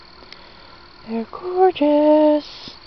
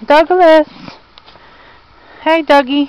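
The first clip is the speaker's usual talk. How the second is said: calling out; 4 semitones higher, 6 dB louder than usual. Real, not acted. Calling out to Doug.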